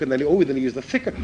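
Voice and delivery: ordinary talk, nothing marked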